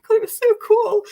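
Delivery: high, loud and thrilled; excited memory. Real, not acted. Recalling dad's dollhouse.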